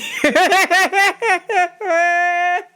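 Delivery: big high cackle; loud laugh. Real, not acted. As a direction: burst out laughing. Audience bursting laughing.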